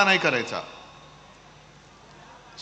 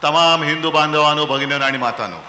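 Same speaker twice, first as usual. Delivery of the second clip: rally speech; 4 semitones lower than usual. Acted. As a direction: call out to the whole crowd. Rally crowd greeting.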